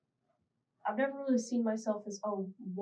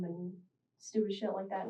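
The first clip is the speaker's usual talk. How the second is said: usual speaking voice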